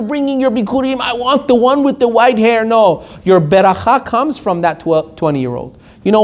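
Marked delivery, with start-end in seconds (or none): 1.0-2.6 high, rattled-off mock insistence; mocking demand. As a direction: parody someone picky about their rabbi. Mocks demand for an older rabbi.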